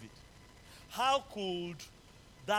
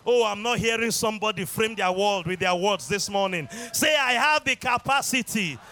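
loud fervent call and response; preaching; 4 semitones higher, 9 dB louder than usual. Acted. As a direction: drive the congregation to shout. Preaching at full force.

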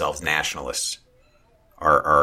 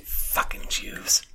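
hushed, slow whispered demo; whispered mock quote; 5 dB quieter, much slower than usual. Shown as a demonstration. Cohost whispering how it could be misheard, whispered. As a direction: whisper it slowly as if quoting. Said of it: "as if I'm saying".

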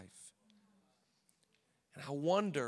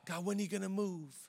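impatient complaint to God; mad at God; 6 semitones higher, 4 dB quieter, faster than usual. Retold or imitated. Believer complaining to God, lightly impatient. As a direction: demand it of God, lightly fed up. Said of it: "we're, like, so mad at God".

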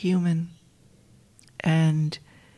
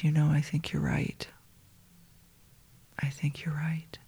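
much softer, almost whispered; hushed agreement; 2 semitones lower, 6 dB quieter than usual. Retold or imitated. Friend agreeing the mom's spirit came, hushed whisper. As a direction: agree softly, in quiet awe.

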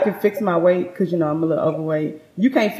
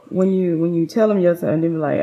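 usual speaking voice

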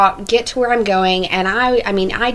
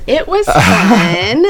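laughing; 5 dB louder, much slower than usual. Real, not acted. Laughing about her trip.

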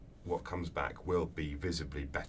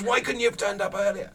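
high, loud booming outburst of glee; a big brute delighted by the fight, shouting; Obelix voice; 10 dB louder than usual. Retold or imitated. Obelix roars at the bandit he's caught, a high loud delighted shout.

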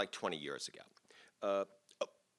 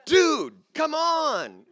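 loud, high, drawn-out protest; hammed-up outraged shout; exasperated yell; 11 dB louder, much slower than usual. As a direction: yell it, stretching each word in exasperation. Retold protest at the campers.

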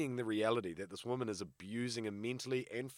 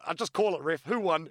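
mock asking the ref; 7 semitones higher, 8 dB louder than usual. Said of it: "And I went".

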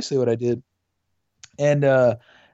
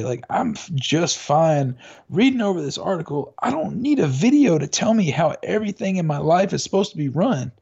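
ordinary delivery

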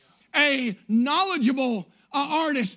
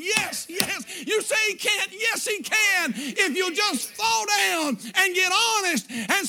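full-force preaching; 5 semitones higher than usual. Acted. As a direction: drive the promise home like a revival climax. Preaching climax.

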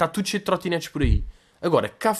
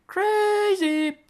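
mock exclaim. Mocking own wine pretence.

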